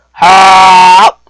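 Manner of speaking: huge sustained "haaa" burst; loud open-mouthed "ha". Retold or imitated. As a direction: blast out a loud, gaping "ha". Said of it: "what he does is he goes".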